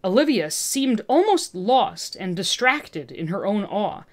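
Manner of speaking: ordinary delivery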